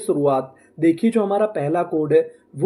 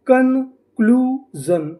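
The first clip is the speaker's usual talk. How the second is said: slow and higher, stretched out with a rise; slow blended word; 4 semitones higher, much slower than usual. Shown as a demonstration. Teacher to students, blending the syllables slowly. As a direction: draw the whole word out. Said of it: "तो हमारी meaning क्या बनी?".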